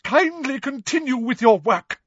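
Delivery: high, shrill dismissive order; overwrought, emphatic command; indignant teacher. A narrator's reading. Professor Trelawney sending her class back to work, indignant.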